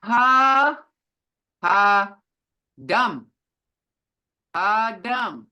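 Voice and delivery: each syllable said apart, loud. Shown as a demonstration. Teacher sounding out a word for the class, syllable by syllable. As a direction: sound it out, one piece at a time. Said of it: "here we go".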